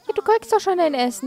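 hurried, exasperated reassurance; impatient. Acted. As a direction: rattle it off at the whining child. Soothing the hungry Sim.

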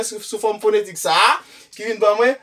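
ordinary delivery

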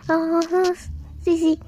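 ordinary talk

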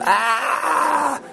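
put-on high, piercing child's yelp; yell of pain. Boy grabbed by a monkey, high pained yell. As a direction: cry out as the monkey grabs your hair. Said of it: "And I started yelling".

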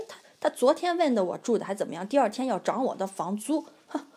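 normal talking voice

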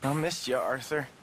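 very low, subdued fondness; tender confession. Acted. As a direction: confess it tenderly, pitch dropped right down. Tender reunion after standoff.

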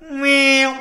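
mock meow over the saw; playful meow. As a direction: meow like a cat as the saw starts. Imitates a noise with the jigsaw.

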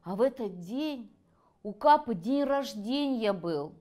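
usual speaking voice

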